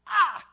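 high, loud groan of regret; anguished groan. Shown as a demonstration. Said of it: "We will never have to say".

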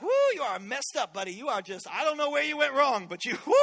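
loud mock-scolding pastor, rising in pitch; hammed-up disapproving pastor; imitated pastor scolding. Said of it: "And he'd be like".

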